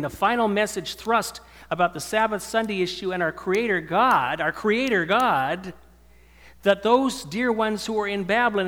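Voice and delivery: loud, rising sermon climax; fervent preaching. Acted. Preacher urging his flock, in full-force preaching. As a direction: preach it out to the whole hall.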